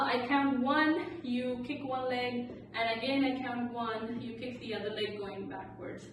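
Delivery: ordinary talk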